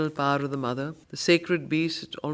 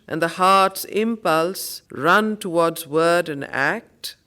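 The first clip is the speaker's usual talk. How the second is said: louder, rising verse declaiming; poem recitation; 5 dB louder than usual. Acted. Teacher reciting verse while quizzing the class, louder and proclaiming. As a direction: proclaim each line to the class.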